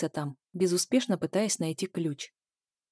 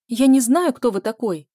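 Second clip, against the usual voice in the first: loud, higher frightened shout; crying out; 4 semitones higher, 9 dB louder than usual. A narrator's reading. Zhenya screams at the intruder in her flat. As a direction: shout it out in panic. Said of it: "закричала Женя".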